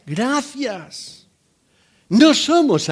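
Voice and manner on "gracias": put-on polite child's thanks. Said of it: "¿Qué es lo que correspondería decir?".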